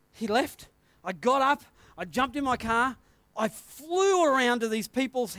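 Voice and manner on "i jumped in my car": ordinary talk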